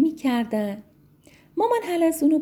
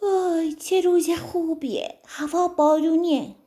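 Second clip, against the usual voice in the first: put-on drowsy baby snail just waking, higher; sleepy little snail; 9 semitones higher, 3 dB louder than usual. The little snail wakes up to the rain, sleepy and higher. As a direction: stretch awake happily, still half asleep. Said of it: "با خوابالودگی".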